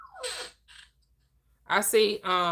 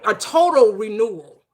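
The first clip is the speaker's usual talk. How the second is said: loud, slow, hammering preacher's declaration; preaching at full force; 3 semitones higher, 9 dB louder than usual. Acted. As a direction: pound the point home like a sermon.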